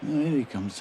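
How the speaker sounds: normal talking voice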